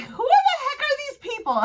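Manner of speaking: high, exaggerated mock bafflement, laughing; imitated baffled question. Companion [name] asking who these people are, mock baffled.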